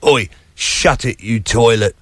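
low, slow Cockney put-down; mock Cockney, dropped low; imitated Cockney insult. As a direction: imitate a pub Cockney telling someone off, slowly. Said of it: "my favourite Cockney insults I heard in the pub".

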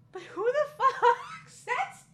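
high, laughing through the quote; laughing disbelief. Real, not acted. Mocking a weird movie line, real laughter. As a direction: crack up mid-line at the dialogue.

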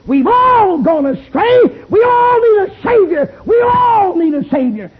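shouted, high, slow preacher's cry; preaching at full force. Acted. A preacher crying out to the tent crowd, shouting.

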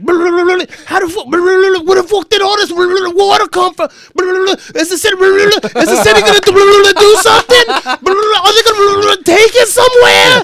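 loud, high rant broken by bubbling babble; gargling, drowning New Yorker. Acted. New Yorker ranting in torrential rain, spluttering outrage. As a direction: shout it through a mouthful of floodwater. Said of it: "go down thirty-fourth and they're like".

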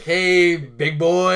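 loud hammed-up hey big boy; loud mock greeting.

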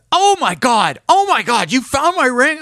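high-pitched, thrilled outburst; excited. Retold or imitated. Ring's owner phoning the man who found it, excited. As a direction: burst out in overjoyed disbelief. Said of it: "this guy's just exci".

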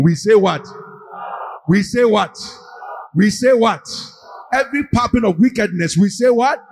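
rally the congregation, building up; booming motivational speech. Acted. Leads congregation call and response.

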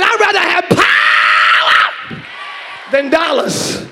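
preacher repeating it bigger; slow emphatic shout. Shown as a demonstration. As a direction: hammer the line home. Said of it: "I said".

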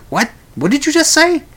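high, puzzled question; baffled what. Retold or imitated. Listener who missed a garbled title, puzzled and high. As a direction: ask in total confusion. Confused listener asking again.

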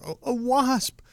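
put-on high little boy's whimper, lightly done; imitating crying child. Dad voicing crying son.

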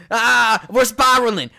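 panicked. Acted. Panicked spiral in the water.

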